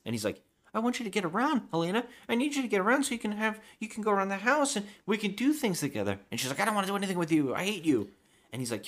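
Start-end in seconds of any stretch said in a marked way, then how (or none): 0.7-6.2 very high, earnest-sounding imitation; hammed-up high, hurried coaxing; mock pleading. Acts him pleading with her. 6.6-8.1 high-pitched retold snub; play-acted high-voiced spite; mock angry rejection. Acts her rejecting him.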